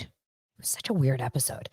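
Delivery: hushed, confiding aside; whispered aside. Real, not acted. Vlogger telling viewers it was weird, whispered. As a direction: whisper it like a secret.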